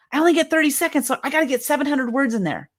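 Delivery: hurried, cramming words in; rushed speaker. Retold or imitated. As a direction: gabble it to squeeze everything in. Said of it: "just running your mouth".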